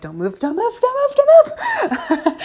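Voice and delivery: high, laughing mock plea that climbs and slows; pleading don't move. Woman begging her partner to freeze, laughing mock plea. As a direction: beg them to stay still, cracking up as you go. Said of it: "they're like".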